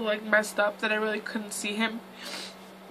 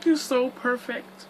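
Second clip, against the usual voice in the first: higher, slow, moved almost to crying; close to tears; 4 semitones higher, slower than usual. Real, not acted. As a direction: say it tenderly, holding back tears. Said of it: "Now I'm gonna start crying".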